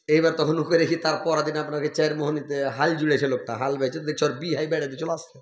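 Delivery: normal talking voice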